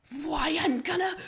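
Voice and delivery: loud, high enraged snarl. Acted. The Empress snarls at you in fury. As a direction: spit it out in rage. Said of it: "she snarls at you".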